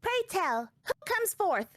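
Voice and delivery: put-on high, haughty little-girl voice; sassy child character. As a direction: play her as a smug, secretive brat. Child greets arriving figure.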